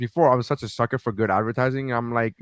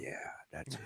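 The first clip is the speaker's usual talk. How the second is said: whispered relief; 24 dB quieter than usual. Retold or imitated. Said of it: "And he's like".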